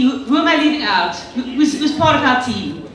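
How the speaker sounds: ordinary talk, nothing marked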